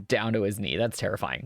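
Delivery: ordinary talk, nothing marked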